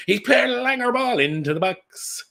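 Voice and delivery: mock the snobby commentators, pitch way up; mocking pundit voice. Mocking how pundits dismiss the Irish manager, high sneering voice. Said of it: "it's like".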